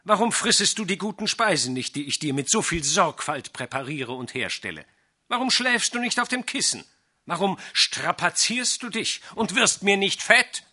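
loud, high, harsh sorcerer scolding the cat; gruff scolding. A narrator's reading. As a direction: bark at the lazy cat. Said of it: "sagte barsch".

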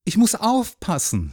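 put-on hesitant boy with a broken start; falter, then pitch up anxiously; uncertain, halting excuse; unsure. A narrator's reading. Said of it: "sagte Jürgen unsicher".

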